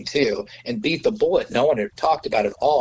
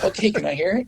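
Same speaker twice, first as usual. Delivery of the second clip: higher-pitched quoted question; acted-out eager request; 8 semitones higher than usual. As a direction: imitate someone asking keenly to hear it. Acting out the engineer's eager request.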